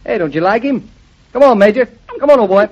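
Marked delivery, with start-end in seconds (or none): 1.3-2.7 eager coaxing. Acted. Coaxing dog, louder.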